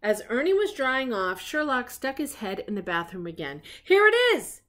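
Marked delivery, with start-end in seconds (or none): 3.8-4.6 high, loud triumphant exclamation; triumphant cry. Acted. Sherlock bursts into the bathroom, crying out triumphantly. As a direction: cry out as if you've cracked the case. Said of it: "he cried".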